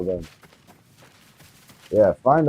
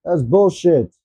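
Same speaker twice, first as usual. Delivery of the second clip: angry complaint; 6 semitones higher, 4 dB louder, slower than usual. Real, not acted. Gamer complains after dying.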